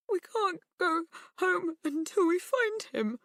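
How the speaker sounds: high, tearful plea; crying. A narrator's reading. Crying girl begging her dad to keep searching, tearful. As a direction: sniff through the tears. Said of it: "she sniffed".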